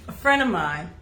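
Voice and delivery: normal talking voice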